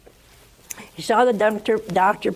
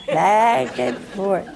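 slowed phrasing; 4 dB louder, much slower than usual. A narrator's reading. Slowed repetition.

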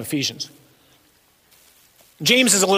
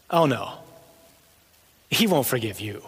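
accusing devil; 3 semitones higher than usual. Acted. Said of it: "he becomes the accuser".

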